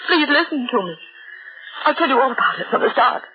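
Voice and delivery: high, indignant and pleading; upset protest. Acted. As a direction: stop them laughing, half angry. She scolds listeners for laughing.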